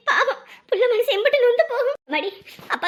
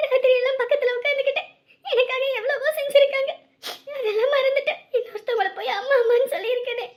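tearful longing; 5 semitones higher than usual. Acted. Girl alone, missing dead mother.

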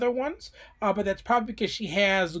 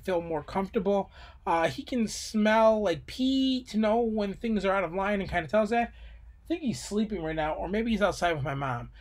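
usual speaking voice

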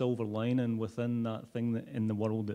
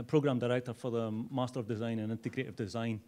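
ordinary talk, nothing marked

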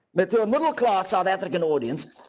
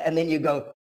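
ordinary delivery

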